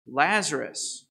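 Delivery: usual speaking voice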